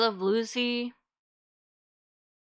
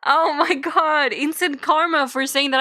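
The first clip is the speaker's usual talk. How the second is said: laughing in pain; 4 semitones higher, 6 dB louder than usual. Real, not acted. Reacts to eye poke.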